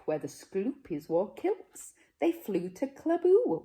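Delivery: ordinary delivery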